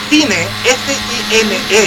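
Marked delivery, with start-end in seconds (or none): 0.1-0.5 FINE read as Spanish "cine", FEE-neh; Spanish spelling pronunciation. Shown as a demonstration. Pero find.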